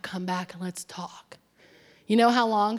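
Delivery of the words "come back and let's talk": soft whispered aside; hushed, under the breath; whispering. Real, not acted. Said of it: "whisper heard".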